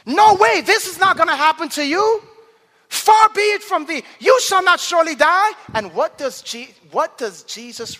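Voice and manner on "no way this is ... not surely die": high, loud, insistent protest; vehement rebuke. Acted. Peter rebuking Jesus over his coming death, vehement rebuke. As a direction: refuse it outright at full voice. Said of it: "began to rebuke him".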